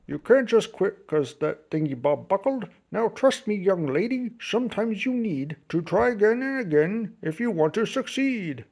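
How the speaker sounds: a hint of a chuckling old grandpa; lightly voiced grandpa. A narrator's reading. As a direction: gently coax like a kind grandfather. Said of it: "Grandpa smiled and chuckled".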